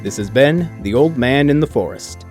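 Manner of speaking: ordinary talk, nothing marked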